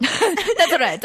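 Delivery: high, hammed-up Italian accent with a laugh; laughing mock Italian accent. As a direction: boast in a sudden Italian accent. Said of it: "I decided to get an Italian accent suddenly".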